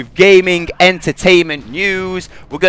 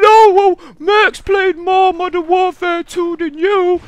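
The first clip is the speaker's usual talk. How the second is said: very high, slow squeaky mock retort; mock whiny fanboy; slower than usual. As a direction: mimic the friend as a whiny kid. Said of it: "It's like".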